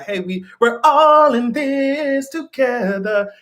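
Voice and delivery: slow, sugary mock reassurance; hammed-up kumbaya peacemaker; mock soothing Charles. Charles coaxing her at the lake, slow mock soothing. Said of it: "and be like".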